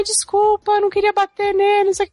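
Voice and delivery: mock apologising girl. Sansa apologising in retold story.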